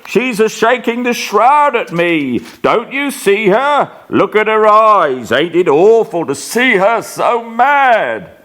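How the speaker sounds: high, panicked pleading; frantic raving. Acted. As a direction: rave at the unseen ghost. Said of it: "he cries out".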